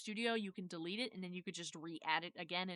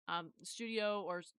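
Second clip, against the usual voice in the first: ordinary talk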